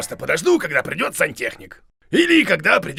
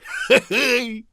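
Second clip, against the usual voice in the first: amused chuckle after a joke; pleased laugh; hearty laugh; 5 semitones higher than usual. Acted. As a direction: laugh at your own quip.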